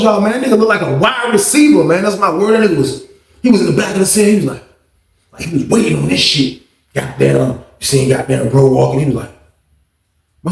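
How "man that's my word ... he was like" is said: usual speaking voice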